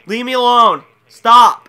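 loud, high plea to stop; exasperated yell. Real, not acted. Gamer to pestering players, exasperated yell. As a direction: yell at them to back off. Pestered by people finding lobby.

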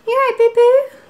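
high, loud coo to a little one; cooing pet name. Real, not acted. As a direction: check on a loved one in a high, sweet voice. Checking on someone called boo-boo.

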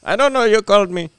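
high, loud mock Indian accent. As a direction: answer back in an imitated Indian voice. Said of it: "I'll give him that voice".

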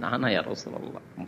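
hushed, fearful admission; owning up in a small scared voice; a timid companion confessing; timid confession. Retold or imitated. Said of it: "একজন ভয়ে ভয়ে বলছেন".

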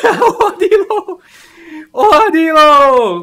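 high, slow, drawn-out shout; catchphrase yell. Pretend. Streamer hamming up his army catchphrase for a game, long high yell.

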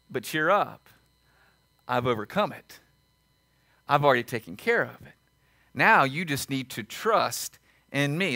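low, soft, comforting voice; calm reassurance. Acted. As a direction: reassure them quietly, well below full voice. God voiced reassuring the troubled.

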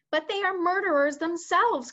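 high-pitched, indignant protest; protesting cry. Acted. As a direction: cry out in objection. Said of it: "cried the head of the army".

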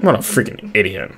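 usual speaking voice

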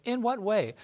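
a mock-innocent "In what way?"; incredulous question. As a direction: ask it as if wrongly accused. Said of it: "six times they said".

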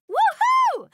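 a high, loud whoop of joy; shrieking woohoo of delight; excited cheer. Acted. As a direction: cheer like you just got picked. Cheers on joining the team.

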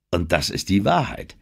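ordinary delivery

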